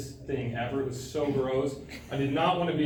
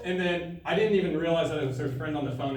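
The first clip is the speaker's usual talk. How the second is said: normal talking voice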